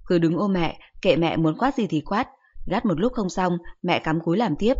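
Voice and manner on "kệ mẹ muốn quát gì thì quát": ordinary delivery, nothing marked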